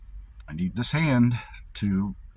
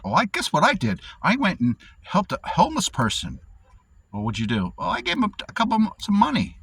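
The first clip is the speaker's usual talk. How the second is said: much higher, proud show-off voice; mock boastful do-gooder; 11 semitones higher, 3 dB louder than usual. Imitating people bragging about helping a homeless person, high and proud. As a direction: puff yourself up over a small good deed. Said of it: "it's like".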